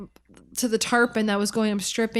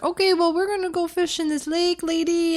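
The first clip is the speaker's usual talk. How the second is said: higher, light mock Spanish accent; 8 semitones higher than usual. Angler voicing her guide's fishing plan in a high mock Spanish accent. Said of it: "me trying to be Spanish".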